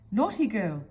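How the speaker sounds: usual speaking voice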